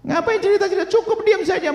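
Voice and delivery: high-pitched, urgent exhortation; full-force preaching. Real, not acted. Preacher orders flock to burn mementos, high and forceful.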